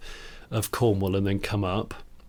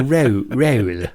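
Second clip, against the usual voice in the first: Bristolian roll; 4 dB louder than usual. Retold or imitated. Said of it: "kept saying".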